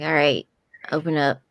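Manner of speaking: commanding. Acted. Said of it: "she's like".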